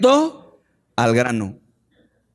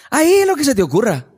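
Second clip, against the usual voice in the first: mock generous God; 11 semitones higher, faster than usual. God voiced as a genie.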